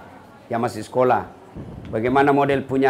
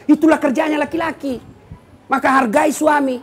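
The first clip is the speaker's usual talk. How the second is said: fiery preaching; 7 semitones higher than usual. Acted. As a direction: preach it out to the crowd. Preacher rises to full force.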